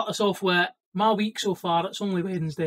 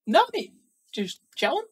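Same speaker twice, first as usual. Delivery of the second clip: mock innocent, much higher pitched; fake innocence; 7 semitones higher than usual. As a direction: play it cool but squeaky, guilty. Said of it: "I'm like".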